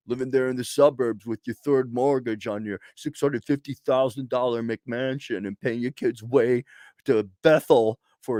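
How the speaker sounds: lightly voiced envious neighbour, sarcastic; a hint of a whiny suburban critic; mock jealous suburbanite. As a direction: turn the envy back on them. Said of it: "the rest of you".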